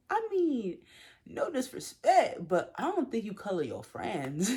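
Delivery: high, pointed put-down, slowing on no disrespect; sassy retort. Retold or imitated. Quoting herself turning down a pushy guy, sassy. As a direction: set him straight with mock politeness.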